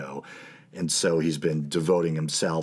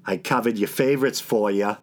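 New England accent; 4 semitones higher than usual. Shown as a demonstration. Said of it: "That's my New England accent".

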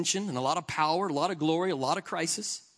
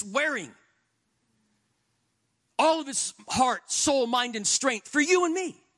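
full-force preaching; 8 semitones higher than usual. Acted. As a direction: preach it, build the fire.

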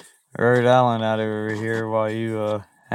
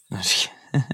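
whisper; 4 semitones lower, 4 dB quieter, faster than usual. Real, not acted. Jokey take-back after a jab.

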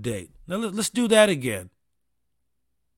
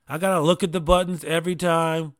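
ordinary talk

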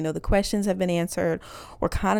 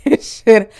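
ordinary delivery

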